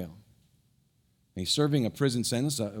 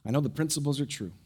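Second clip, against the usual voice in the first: soft, lower prayerful voice; 4 semitones lower, 3 dB quieter than usual. Real, not acted. Pastor praying over the congregation, soft. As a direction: pray it gently and sincerely.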